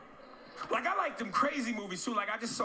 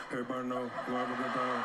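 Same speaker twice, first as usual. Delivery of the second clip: Shaq impression; 10 semitones lower than usual. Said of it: "When Shaq talked".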